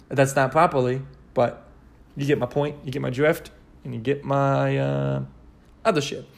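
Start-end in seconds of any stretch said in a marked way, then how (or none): none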